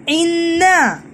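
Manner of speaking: इन्ना with the नून held long, falling to close; doubled n in इन्ना. Shown as a demonstration. Teacher showing children how to read the word, इन्ना with doubled n. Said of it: "इस लफ्ज को हम इन ना पढ़ेंगे".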